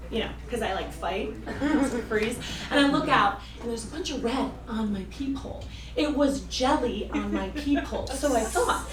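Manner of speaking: normal talking voice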